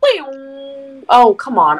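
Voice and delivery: usual speaking voice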